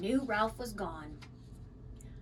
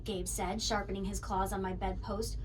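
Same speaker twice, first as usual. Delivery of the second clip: ordinary delivery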